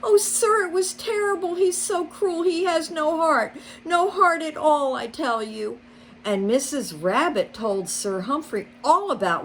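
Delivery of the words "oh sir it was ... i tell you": very high, upset outpouring; distraught. A narrator's reading. Rabbit telling Sir Humphrey of the cruel fox, very high and upset. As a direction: rush through the complaint.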